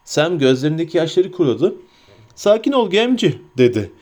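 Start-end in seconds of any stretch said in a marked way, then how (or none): 2.3-3.4 high hobbit voice, steadying himself; Sam. A narrator's reading. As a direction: talk yourself down, pitched high. (tiz sesle kendini yatıştıran hobbit)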